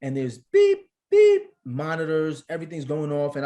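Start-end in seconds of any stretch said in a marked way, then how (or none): 0.4-0.9 loud, held electronic "beep"; imitated monitor beep. Nervous father describing the delivery room, imitated beep. 1.1-1.4 loud, held electronic "beep"; imitated monitor beep. Nervous father describing the delivery room, imitated beep.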